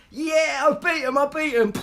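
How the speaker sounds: high, loud triumphant crowing; gleeful gloat. Real, not acted. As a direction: crow over the win. Gloating about beating someone.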